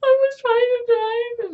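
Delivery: high-pitched mock wail, words drawn out; crying girl talk. Girl explaining herself to the cop, mock crying. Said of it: "you know how girls talk, like, when they're crying".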